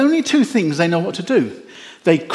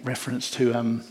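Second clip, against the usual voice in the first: usual speaking voice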